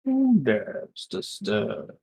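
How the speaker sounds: lightly voiced, slow woken deity; sleeping ancient god. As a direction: drawl it like a god just roused. GM echoes the line in the voice.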